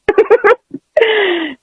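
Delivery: high laughter trailing downward; real high giggle, falling at the end; amused laugh. Real, not acted. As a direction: laugh it out after your joke. Laughs after joking about her prayers.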